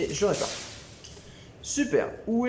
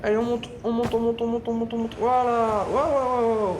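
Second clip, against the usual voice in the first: higher, louder chant building to wow; rising thrill; 5 semitones higher, 5 dB louder than usual. Real, not acted. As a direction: egg yourself on up, then marvel. Climbing, then thrilled. (montée exaltée, plus aiguë et plus forte)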